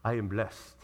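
put-on low, subdued African accent. Pastor retelling a man's reply in conversation, African accent. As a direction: imitate a heavy African accent. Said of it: "in his heavy African accent".

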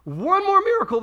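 rich man's plea. Retold or imitated. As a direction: beg as the tormented rich man. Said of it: "He says".

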